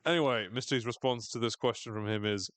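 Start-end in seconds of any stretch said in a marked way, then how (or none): none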